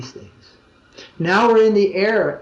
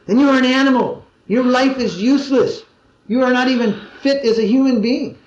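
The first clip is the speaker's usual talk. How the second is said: mock scolding in a higher pitch; harsh condemnation; 5 semitones higher than usual. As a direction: imitate a guru berating a newcomer, pitched up. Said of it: "And he reduced her to tears".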